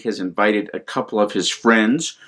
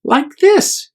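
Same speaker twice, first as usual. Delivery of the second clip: penguin voice; 4 dB louder than usual. Acted. Penguin shows cuddle.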